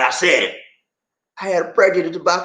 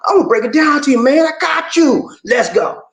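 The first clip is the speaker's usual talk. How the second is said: hype; 9 semitones higher, 5 dB louder than usual. Acted. Switches back as hyped host.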